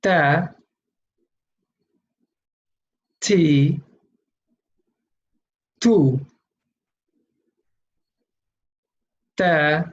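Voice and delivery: "Ta, ti, tu" as isolated syllables; letter with three vowels. Shown as a demonstration. Teacher for students to repeat aloud, syllable drill on "Ta, ti, tu". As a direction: sound out "Ta, ti, tu" with a pause after each. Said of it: "pronounce all the letters with all three sounds".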